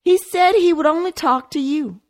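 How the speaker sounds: croaking, quivering fear. A narrator's reading. Frightened maid reporting the detective's call, quivering. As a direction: let the voice crack with fright. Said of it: "Carla's voice croaked and quivered".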